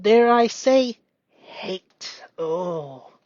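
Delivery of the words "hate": the word hate whispered; whispering the hard word. Real, not acted. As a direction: breathe out hate. Whispered naming.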